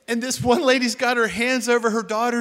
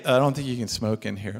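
timid mock voice; 10 semitones lower, 3 dB quieter than usual. His timid remark to the smoker.

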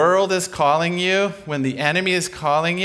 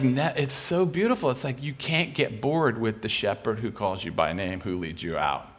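normal talking voice

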